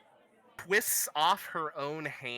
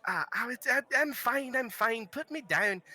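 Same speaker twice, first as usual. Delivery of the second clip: flustered protest; 10 semitones higher than usual. Acted. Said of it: "he's like".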